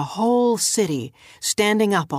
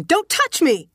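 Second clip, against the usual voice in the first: high, sharp cry of panic; high-pitched scream of a line; 11 semitones higher, 4 dB louder than usual. A narrator's reading. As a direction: cry out high, warding someone off. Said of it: "in a voice like the Stormbringer's scream".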